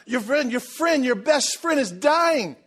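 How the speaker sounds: high, urgent, slowed pleading cry; frantic crying out. Retold or imitated. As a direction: mimic the disciples begging Jesus to hurry. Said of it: "They're crying out".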